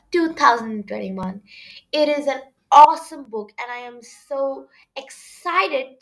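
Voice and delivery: promo announcement. Acted. Host announcing her new book podcast, upbeat promo.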